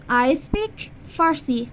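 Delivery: slow, careful teacher model of "I speak فارسی"; slow model sentence. Shown as a demonstration. Teacher to Persian learner, slow and clear. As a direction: say each word slowly for the student. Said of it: "باید بگی".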